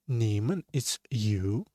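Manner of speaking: ordinary delivery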